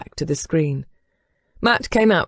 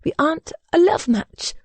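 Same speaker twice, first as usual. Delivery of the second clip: higher, strained, words nearly sticking; choked up; 11 semitones higher than usual. A narrator's reading. Bride struggling to deny the marriage is love, choked up. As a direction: force it out past a tight throat. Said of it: "She almost choked on the words".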